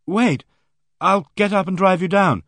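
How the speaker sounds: high, loud call across the house; calling after. Acted. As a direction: call out so he hears you at the door. Calling after someone leaving the door.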